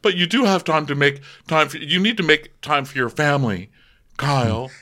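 lightly voiced housewife, urging; imitating Kathy. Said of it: "And she goes".

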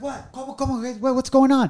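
high-pitched flustered questions; startled panic. Retold or imitated. Husband woken by wife's water breaking, startled. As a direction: act out being jolted awake in alarm. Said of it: "I remember jumping out of bed".

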